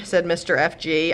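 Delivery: ordinary delivery, nothing marked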